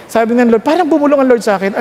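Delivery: ordinary talk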